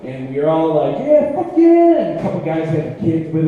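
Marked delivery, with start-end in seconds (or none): 1.0-2.0 loud, high group whoop; cheering. Retold or imitated. Friends loaded in the van cheering, loud whoop. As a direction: whoop it like the road trip just started.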